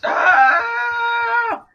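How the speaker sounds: high, loud retold scream of terror; imitated scream. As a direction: shriek like someone attacked outside. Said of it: "you just hear this scream".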